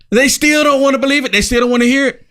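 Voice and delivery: ordinary talk, nothing marked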